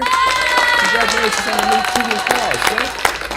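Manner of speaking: very high, loud congratulating; excited shout. Real, not acted.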